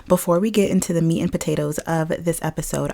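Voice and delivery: ordinary talk, nothing marked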